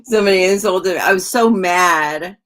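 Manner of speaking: loud, amused exclamation after a laugh; mock outrage. Streamer laughing over being insulted in a dream, mock outrage. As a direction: belt it out in playful indignation, stretching the end.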